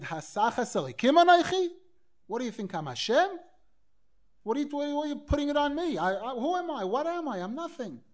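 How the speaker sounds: high, indignant protest, imitating the tzaddik; strong exclamation. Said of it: "Maybe I shouldn't have said it so strong".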